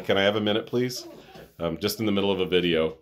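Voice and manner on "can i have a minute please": raised, high-pitched call to someone off camera; real request for a minute, louder and higher. Real, not acted. Vlogger to interrupting ex-wife in next room, calling out.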